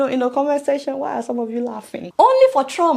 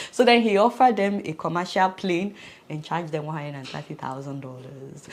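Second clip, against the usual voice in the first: usual speaking voice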